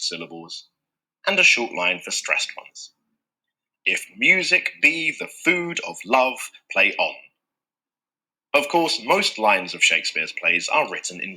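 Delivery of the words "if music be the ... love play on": slow and measured, the iambic beats marked; iambic recitation. Shown as a demonstration. Teacher reciting Twelfth Night in slow, marked iambs. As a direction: speak it like a stage line, deliberate pulse. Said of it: "Shakespeare's favourite metre".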